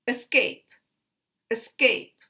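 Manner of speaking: "Escape" with a schwa first syllable, SCAPE strong; weak-strong stress. Shown as a demonstration. Teacher showing weak then primary, "escape" stressed on SCAPE. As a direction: reduce the start of "escape" to uh and punch SCAPE. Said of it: "a weak and the strongest primary".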